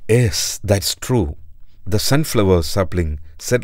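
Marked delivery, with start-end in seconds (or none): none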